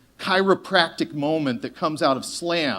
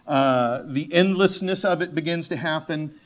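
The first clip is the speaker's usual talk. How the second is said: ordinary delivery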